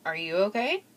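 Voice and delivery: acted-out dad checking on his kid; dad's pointed question. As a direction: question swinging upward at the end. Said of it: "he does this number".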